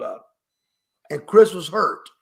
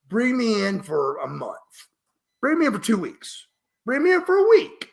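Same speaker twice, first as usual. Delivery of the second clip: escalating plea; 6 semitones higher than usual. Retold or imitated. Wrestler offering to come in for less time, escalating plea. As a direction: pitch climbing with each offer, to a high peak. Retold bargaining plea to promoter.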